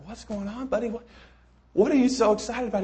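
high, curious dad asking his son; lightly voiced questioning dad; puzzled dad voice. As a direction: ask like a bemused father. Dad asks excited kid.

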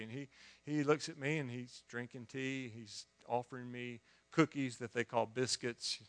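normal talking voice

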